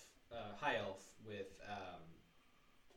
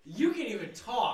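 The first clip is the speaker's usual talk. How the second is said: laughing shout; 11 semitones higher, 13 dB louder than usual. Real, not acted. Teasing a player who fumbles.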